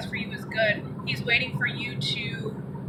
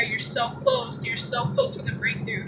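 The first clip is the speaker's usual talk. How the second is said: tender voice of God; 12 semitones higher than usual. Acted. God's comforting words voiced.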